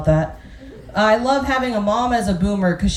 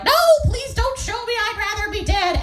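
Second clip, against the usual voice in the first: high, dramatic horrified mother; high, hammed-up pleading mother; mom voice; 12 semitones higher than usual. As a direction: squeal it like a mortified mom. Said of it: "she's like".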